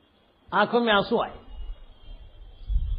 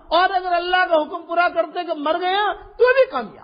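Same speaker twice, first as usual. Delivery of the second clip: crying while preaching; 2 semitones higher than usual. Real, not acted. Preacher to congregation, weeping as he retells the martyr's words. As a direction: voice breaking into tears.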